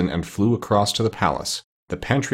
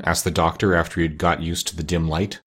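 plain reading voice

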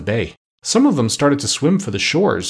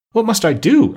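higher, louder pleading question; anxious pirate; 11 semitones higher, 5 dB louder than usual. A narrator's reading. Pirate threatened by a shark asking the doctor, anxious. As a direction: ask it high and urgently, as a cornered pirate.

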